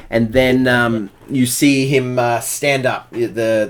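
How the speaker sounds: usual speaking voice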